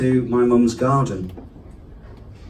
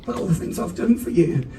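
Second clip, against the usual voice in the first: indignant dog; 8 semitones higher, 10 dB quieter than usual. Pretend. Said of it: "was like".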